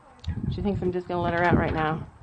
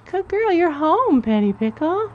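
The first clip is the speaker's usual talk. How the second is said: sweet praise; 8 semitones higher, 4 dB louder than usual. Real, not acted. Praising the horse on arrival.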